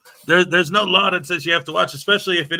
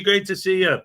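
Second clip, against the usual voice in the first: ordinary delivery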